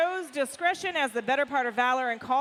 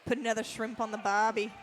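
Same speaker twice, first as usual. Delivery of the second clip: low Aussie catchphrase voice; Australian accent; 4 semitones lower, 5 dB quieter, faster than usual. Pretend. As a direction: lay on the Australian twang.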